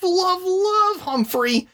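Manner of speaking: high, slow squeaky hamster. Pretend. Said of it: "of Humphrey".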